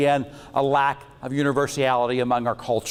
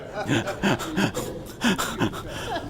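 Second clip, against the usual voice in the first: breathy laugh; 4 semitones higher, 5 dB quieter than usual. Real, not acted. Laughs at own panda joke.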